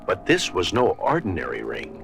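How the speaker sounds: plain reading voice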